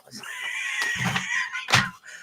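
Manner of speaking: sudden squealing burst of laughter; shrieking laugh. Real, not acted. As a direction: let a real laugh burst out high. Bursts out at a comment.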